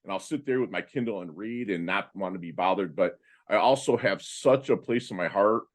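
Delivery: ordinary talk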